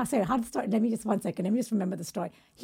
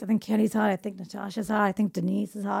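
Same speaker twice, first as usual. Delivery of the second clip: a hint of a muffled, deeper boy's voice; 4 semitones lower, 5 dB quieter than usual. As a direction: lightly mumble it like the boy, a bit deeper. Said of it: "again, very muffled, deep voice".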